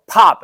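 loud imitated pop; mock smacking noise, loud; smack sound. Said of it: "I take a stick and I go".

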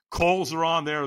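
ordinary talk, nothing marked

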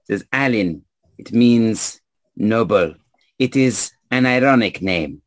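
put-on measured introduction in a French accent; French-accented stranger explaining a name. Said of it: "stranger with a French accent".

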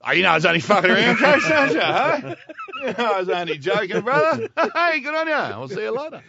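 loud, high, fast nervous laughing back-down; grovel, all matey and panicky; sheepish backpedal. Retold or imitated. Backpedalling to coach after draft news.

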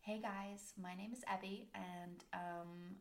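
lightly put-on Californian accent. Shown as a demonstration. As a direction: try a soft, casual Californian hello. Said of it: "a little bit of Californ".

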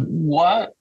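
retold disbelief. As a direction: act out mock shock at a friend. Retelling his shocked reaction.

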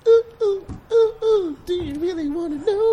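very high squeaky character voice; hammed-up high crying voice; high put-on voice.

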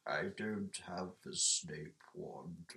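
low, slow, quiet impression; half-baked wizard professor voice, slow and low; Snape. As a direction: drop the pitch and draw the words out softly. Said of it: "your Snape impression's shit".

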